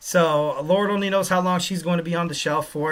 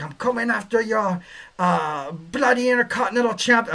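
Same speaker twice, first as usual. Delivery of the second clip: bad Scottish accent; 4 semitones higher than usual. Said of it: "I can't do a Scottish accent, or Irish".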